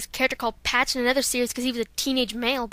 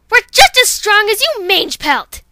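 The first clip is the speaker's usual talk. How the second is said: defiant shout; 7 semitones higher, 12 dB louder than usual. Acted. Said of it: "here's Violet Mist".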